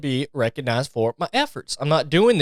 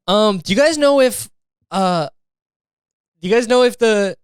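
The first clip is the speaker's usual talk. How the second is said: put-on innocent girl asking; 6 semitones higher than usual. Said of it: "she's just like".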